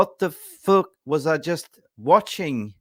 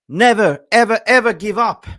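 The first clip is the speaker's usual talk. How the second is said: higher, loud defiant cry; rallying shout; 6 semitones higher, 8 dB louder than usual. Real, not acted. Fan shouting the club's never-give-up spirit to viewers.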